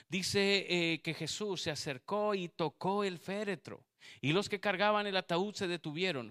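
loud sermon delivery; full-force preaching. Acted. Preacher to the congregation, loud preaching. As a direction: drive the point home.

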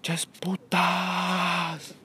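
very slow, high, airless whisper; mock drunk whisper, drawn out; drunken whisper. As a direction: imitate a drunk hissing it under his breath. Drunk friend muttering insult.

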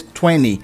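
TWENTY as "tweni", T dropped; twenty without T. Shown as a demonstration. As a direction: skip the T in TWENTY. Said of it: "a forma que eu vou mais falar".

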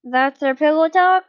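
mock the question with sarcasm; sarcastic. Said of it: "the blonde spoke sarcastically".

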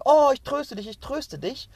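high, loud gushing "Oh" that drops back at once; hammed-up pity, pitched high on the Oh; mock comforter. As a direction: ham up the fussing rescuer, then deflate. Mocks people rushing to comfort.

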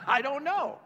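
high laughing "I don't know". Real, not acted. Pastor laughing off his jerky boss joke, laughing.

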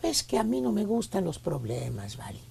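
hushed, unhurried and mellow; soft calm voice. Retold or imitated. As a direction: say it gently like nothing bothers you. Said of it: "Con voz suave, güey, bien tranquilón".

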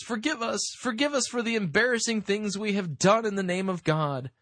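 high-pitched mea culpa read-out; mock pleading. Host quoting a writer's mea culpa, mock pleading. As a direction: plead it high, as a mocking quotation.

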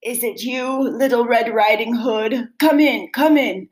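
lightly hoarse granny voice, rising; a hint of a croaky granny calling in; hoarse wolf voice. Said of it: "a hoarse voice answered".

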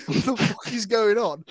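what on earth is this spider doing; shocked. Retold or imitated. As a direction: friend reliving a standoff with a spider, disbelief. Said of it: "I'm like".

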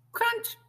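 sharp high crunch; crunch sound effect. A narrator's reading. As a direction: pop out the crunch like a cracking shell. Shell cracks.

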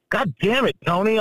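retold outburst, pitched high without extra volume; high-pitched, exaggerated exasperation; mock mad. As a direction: play up being mad at the commentator. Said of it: "I, I was all mad".

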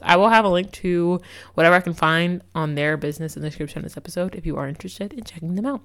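ordinary talk

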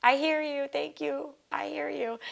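high, heartfelt thanks upward; thanking her late dog in heaven, high and moved; grateful to the sky. Retold or imitated. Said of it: "And I was like".

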